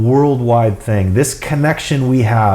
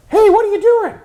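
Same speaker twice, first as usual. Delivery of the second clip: tiny parrot voice, very high; Iago from Aladdin; 3 dB louder than usual. Retold or imitated. Parrot calling out to a customer, tiny high voice. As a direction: mimic a cheeky talking bird, pitched way up. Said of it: "this tiny voice, like, it sounded like Iago".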